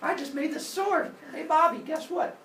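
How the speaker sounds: put-on high, excited kid-brother voice; playful brother. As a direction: show off a new toy in a high voice. Brother shows off new sword.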